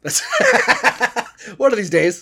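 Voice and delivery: said through laughter; laughing. Real, not acted. Podcaster cracking up at a callback to the boxing bit, laughing. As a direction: crack up while delivering the callback.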